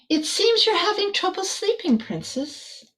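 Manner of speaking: a hint of a kindly stargazer; astronomer voice.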